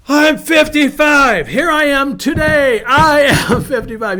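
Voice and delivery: loud, high shout with laughter breaking in; exultant declaration. Acted. Pastor declares his own age for today, exultant shout. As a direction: shout your age like a battle cry. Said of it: "I wanna say".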